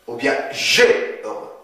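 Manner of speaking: ordinary talk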